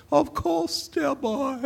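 airy, lofty reply from a grand stage actor; Gielgud impression. Gielgud agreeing to be Chancellor, high and theatrical. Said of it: "I remember him saying".

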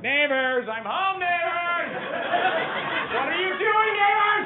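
comic owner bellowing at the dog through the walls; yelling at the dog. Retold or imitated. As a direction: holler at the dog so the neighbors hear. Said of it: "every time I yell at my dog".

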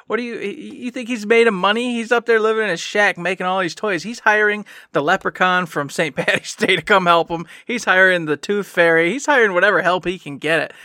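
light Irish elf rant; Irish elf accent. Acted. Elf explains Santa's hiring in light Irish accent. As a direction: rattle on in a loose Irish lilt. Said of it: "Don't hire weird Irish elves".

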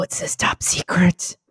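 unvoiced whisper reading; hushed whisper. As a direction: whisper it in awe. Reading in hiding.

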